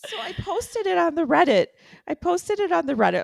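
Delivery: high, laughing through the words; giggling as she admits it; breathless giggly telling. Real, not acted. Laughing while telling story.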